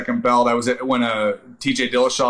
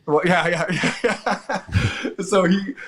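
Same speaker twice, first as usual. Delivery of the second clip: laughing; 5 semitones higher, slower than usual. Real, not acted.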